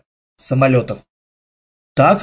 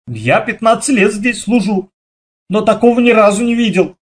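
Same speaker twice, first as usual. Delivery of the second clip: ordinary delivery, nothing marked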